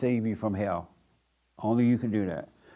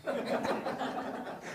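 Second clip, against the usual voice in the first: breathy, wordless laugh; soft wheezy chuckle after the joke; 13 semitones higher, 13 dB quieter than usual. Real, not acted. Preacher amused by his own mama impression, laughing.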